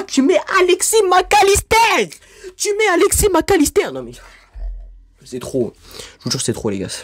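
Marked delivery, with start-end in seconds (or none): 0.0-3.9 much higher and louder, incredulous rage; furious shout. Real, not acted. Vlogger raging at voters over Mac Allister, furious shout. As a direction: yell the name in disbelief.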